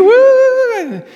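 a sudden piercing high woo like a fan at a concert; high whooping cheer. Pretend. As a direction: whoop as if the star is walking on stage. Cheering for [name].

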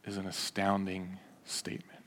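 hushed whisper for effect; whispered astonishment. Real, not acted. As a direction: breathe the words out in awe. Dramatic reveal in sermon.

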